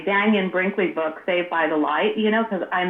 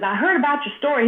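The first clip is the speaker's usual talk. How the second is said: imitated southern speaker, pitched up; higher-pitched mock southern voice; southern accent; 6 semitones higher than usual. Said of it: "you know, with a southern accent".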